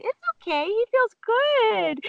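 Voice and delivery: imitating a horse lover's soothing coo; high-pitched cooing. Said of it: "in this high-pitched voice".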